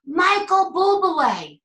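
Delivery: high, loud, slow drawn-out call; yell out. Retold or imitated. Shouting a singer's name from the cruise audience, loud and slow. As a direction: call the name out across the room. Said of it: "and I yell out".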